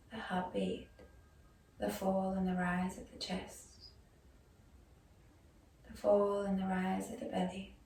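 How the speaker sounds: guided meditation. Acted. Yoga teacher guiding students into breath and body awareness, soft meditation voice. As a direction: ease them gently into stillness, leaving space after each phrase.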